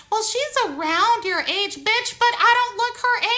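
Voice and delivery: high mock voice of the woman; mocking quote. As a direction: pitch it up, mimicking her. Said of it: "she's like".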